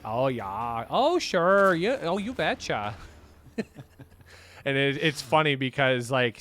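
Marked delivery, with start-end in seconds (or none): 0.0-2.9 hammed-up upper Midwest accent, pitch jumping up on sure; heavy upper Midwest accent. Teasing his friends' speech, mock upper Midwest accent. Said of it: "they lay heavy into the".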